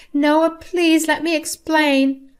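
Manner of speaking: high, slow begging voice; pleading mother-in-law. Acted. Mother-in-law begging her son, high and slow.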